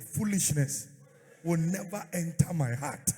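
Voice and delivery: loud fervent shout. Shown as a demonstration. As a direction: shout it out with conviction. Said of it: "Shout it".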